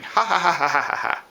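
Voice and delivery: woodpecker laugh imitation. Teacher imitating the woodpecker's laughing call, choppy cackle. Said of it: "Woodpeckers sound like they're laughing".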